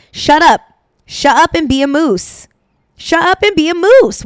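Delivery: teacher snapping at a self-conscious student, mock scolding. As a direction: bark it like a fed-up dance teacher.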